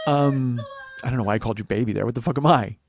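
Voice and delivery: ordinary talk, nothing marked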